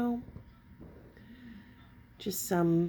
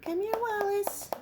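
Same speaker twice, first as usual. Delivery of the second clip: high sing-song call; high pet call; calling the cat; 11 semitones higher, 3 dB louder than usual. Real, not acted.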